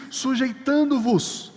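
slow, weighted sujeitando-vos, every syllable stretched; drawn-out quote. Shown as a demonstration. Preacher to congregation, quoting the text slowly. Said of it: "Por isso que o texto diz".

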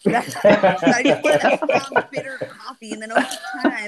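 laughing while speaking; amused, laughing it out; talking through laughter. Real, not acted. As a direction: let the giggles run through the words. Laughing at own coffee joke.

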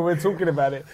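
usual speaking voice

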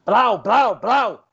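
batidas fortes no vidro; batidas no vidro imitadas. Retold or imitated. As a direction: imita a lanterna batendo no vidro. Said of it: "e já bateu no vidro". (imitando o policial batendo forte no vidro)

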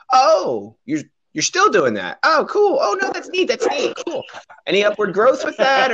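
high, mock-chirpy condescending voice; a patronizing wedding guest; fake polite acquaintance. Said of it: "And they go".

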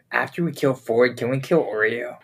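ordinary talk

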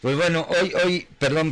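cigar in mouth. Real, not acted. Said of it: "me agarraron con el cigarro en la boca".

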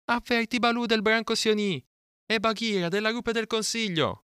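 very high, sustained chant-like call; Mowgli crying out. A narrator's reading. Captive boy sends word to his guardians, high sustained cry. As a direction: call the message up to the sky. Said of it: "gridò Mowgli".

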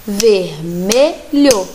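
Vermelho drawn out slowly, syllable by syllable. Shown as a demonstration. Teacher counting beats for children, slowly. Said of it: "bater um lápis vermelho no outro três vezes".

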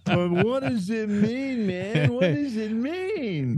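high, drawn-out wail of puzzlement; mock bewilderment. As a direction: stretch each word way up high, hamming it up.

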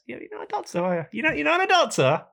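high, sneering mock voice; mocking retort. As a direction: taunt her in a put-on high voice. Retelling his own jibe.

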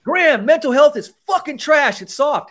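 high, loud jeering insult; hammed-up angry heckling; mock hostile rant. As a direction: sneer it like a heckler. Role-play hostile citizen.

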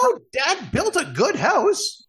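high, slow gnome voice; reassuring high-pitched little sister. Acted. Gnome reassures her brother.

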